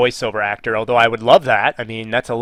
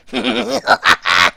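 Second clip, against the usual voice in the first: high-pitched cartoon villain cackle and cries; Koopaling noises; 9 semitones higher than usual. Shown as a demonstration. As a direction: cackle and yelp like a little game villain. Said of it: "I'll do some Koopaling noises".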